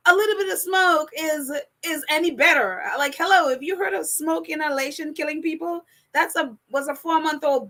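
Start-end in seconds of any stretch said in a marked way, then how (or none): none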